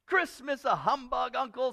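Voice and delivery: high, loud protest; cheerful young relative's incredulous reply; Scrooge's nephew. Acted. Nephew protests to his uncle, high cheerful voice. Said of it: "said Scrooge's nephew".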